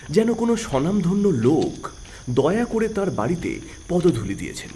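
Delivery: ordinary delivery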